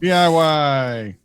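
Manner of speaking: slow, scornful D I Y; disdainful. Real, not acted. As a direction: drag it out with distaste. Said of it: "[name] isn't a fan of a".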